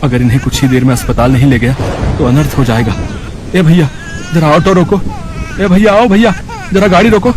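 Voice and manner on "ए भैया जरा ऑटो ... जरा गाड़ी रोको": calling out for help. Acted. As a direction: shout to stop the autos on the road. Flagging down passing vehicles for help.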